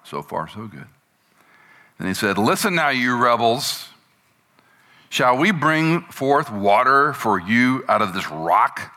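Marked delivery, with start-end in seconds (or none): 2.4-8.9 a touch of angry Moses, higher at the start and slow. Acted. Moses rebukes the rebels at the rock, with mild slow anger. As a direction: scold the rebels, deliberately and slowly. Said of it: "He was ticked".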